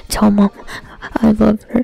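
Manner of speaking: loud hammed-up farewell; joking dying farewell to mom, loud; mock dying words. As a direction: ham up a deathbed goodbye. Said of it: "I'm like".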